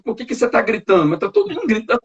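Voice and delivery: high-pitched mock shouted squabble; imitating family yelling. Pastor mimics a complaining family shouting at each other, mock yelling. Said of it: "aí tá gritando".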